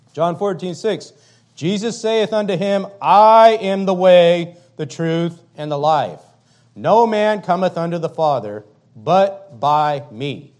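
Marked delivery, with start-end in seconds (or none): 3.0-10.5 slow booming proclamation of scripture; proclaimed quote. Acted. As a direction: thunder out Jesus' words, slow. Preacher proclaims Jesus' words.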